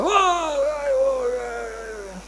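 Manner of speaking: high whoa dropping to soft ohs; hammed-up diving whoops. Big man diving into the lake, delighted whoops. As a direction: whoop as you hit the water.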